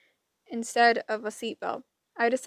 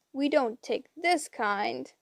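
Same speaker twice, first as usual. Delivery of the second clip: plain reading voice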